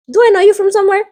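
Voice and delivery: high, sly pick-up line; mock a leering professor; creepy teacher. Professor to student, high sly come-on.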